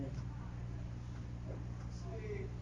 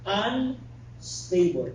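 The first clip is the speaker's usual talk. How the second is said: drawn-out term; 3 semitones higher, much slower than usual. Shown as a demonstration. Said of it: "The opposite is yaw".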